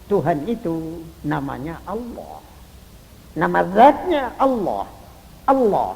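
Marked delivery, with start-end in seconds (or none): none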